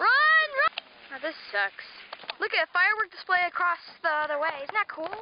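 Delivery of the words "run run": high, loud call to run; urgent shout. Real, not acted. Kid warning others away from a firework, urgent shout.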